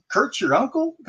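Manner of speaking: high, amazed question. Retold or imitated. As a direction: lift it in disbelief. Athlete learns about the uncle.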